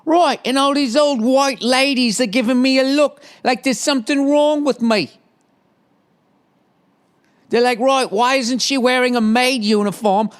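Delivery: high-pitched, hammed-up English accent. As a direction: put on a silly high English voice. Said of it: "why she has an English accent".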